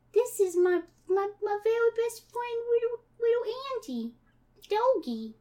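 put-on high, babyish child voice; eerie little girl Sandy. Sandy presenting her decomposing dog, high cutesy child.

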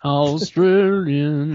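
slow, drawn-out Australian; mock Australian accent. Player echoing the category pick, slow mock Australian accent. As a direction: stretch it out in an Australian twang.

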